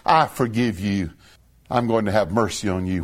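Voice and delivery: low soft I forgive you; a wronged person quietly forgiving the offender; gentle forgiveness. Retold or imitated. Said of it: "for you to say".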